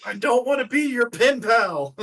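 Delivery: high, very loud comic wail; mock-protest yell. Player protesting being the villain's pen pal, mock-protest yell. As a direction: yell it like a hammed-up tantrum.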